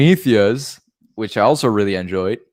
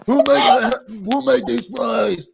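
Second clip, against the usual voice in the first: Arnold Schwarzenegger impression; 11 semitones higher than usual. Shown as a demonstration. Requested impression, Arnold finding an onion ring in his fries, hammed-up Austrian. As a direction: bellow it in a hammed-up Austrian accent. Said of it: "do an Arnold Schwarzenegger impression".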